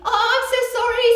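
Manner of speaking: high, fast, loud embarrassed apology; fast flustered Mrs Thomas. Acted. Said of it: "said Missus Thomas".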